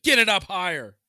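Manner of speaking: very loud, high mock order; imitating a furious command; retold angry shout. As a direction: yell it like the bossy custodian. Retold custodian yelling.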